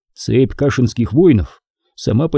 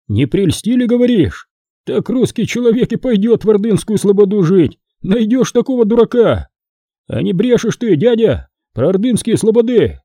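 high-pitched, disbelieving villagers; incredulous questioning, pitched well up; doubting peasants; 8 semitones higher than usual. A narrator's reading. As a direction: challenge the tale with open scepticism. Peasants challenge Foma's story.

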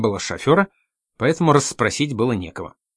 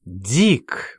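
usual speaking voice

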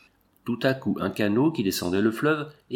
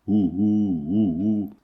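wind howl; 2 semitones lower, much slower than usual. Acted. Wind howling echo.

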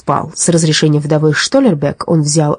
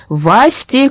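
higher-pitched sharp call; 7 semitones higher than usual. A narrator's reading. Policeman calling off his dog, mild shout. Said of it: "крикнул господин Дипфельмозер".

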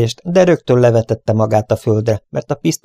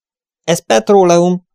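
high, loud, slow alarm; alarmed cry; 9 semitones higher, 5 dB louder, slower than usual. Acted. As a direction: cry out as the smoke hits. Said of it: "kiáltotta föl Dick".

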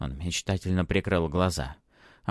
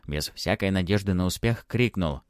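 plain reading voice

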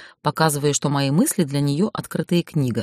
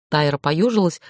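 plain reading voice

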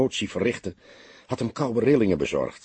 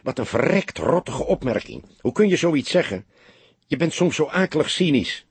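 raised, higher-pitched indignant outburst; narrated exasperated protest; indignant reproach; 6 semitones higher than usual. A narrator's reading. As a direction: scold a colleague in disbelief. Indignant reproach in an acted quarrel.

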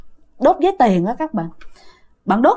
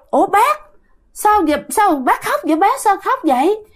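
puzzled asking; 9 semitones higher than usual. Retold or imitated. Asking crying uncle's ghost.

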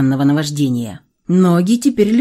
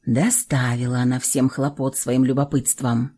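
usual speaking voice

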